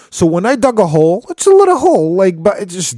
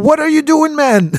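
high, exasperated protest; incredulous cry; 12 semitones higher than usual. Retold or imitated. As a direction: throw up your hands in disbelief. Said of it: "at Khidr and is like".

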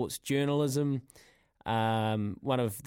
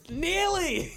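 mocking echo. Real, not acted.

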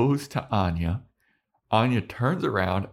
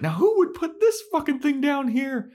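high squealing outrage; mock Anya; 3 dB louder than usual. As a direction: squeak it like a startled girlfriend. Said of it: "she's like".

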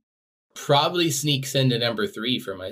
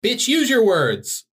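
mock exasperated; 10 semitones higher, 5 dB louder, slower than usual. Mock scolding the writer.

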